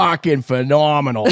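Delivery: Boston accent repeated on cue. Shown as a demonstration. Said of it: "Boston accent".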